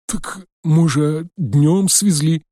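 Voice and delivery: put-on high, slow hesitant old man; timid old man. A narrator's reading. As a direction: offer the guess like a nervous old man.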